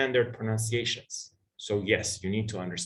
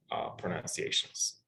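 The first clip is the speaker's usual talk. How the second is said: ordinary delivery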